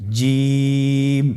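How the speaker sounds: জিম with the vowel held long, three alif; drawn-out Jim. Shown as a demonstration. Teacher modelling জিম with a long drawn vowel. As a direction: stretch জিম slowly for the class to repeat. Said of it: "আপনারা আমার সাথে বলুন".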